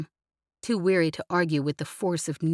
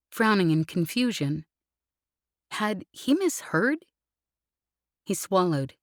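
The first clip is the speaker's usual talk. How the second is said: ordinary delivery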